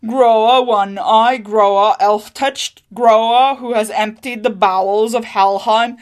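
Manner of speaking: slow, loud, solemn litany; grim recitation of titles. A narrator's reading. Husband reciting the feared witch's titles, slow and loud. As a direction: intone each title like a doom.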